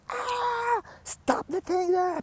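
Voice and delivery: high, shrieking mock scream; hysterical scream. Toronto electrician yelling to stop the show, shrieking. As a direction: shriek like a panicking crew chief. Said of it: "Il crie, il hurle".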